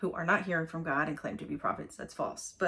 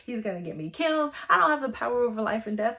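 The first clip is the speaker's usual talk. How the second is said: louder, higher panicking king; king panicking over the letter; panicked king; 5 semitones higher, 6 dB louder than usual. Retold or imitated. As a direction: freak out as the king.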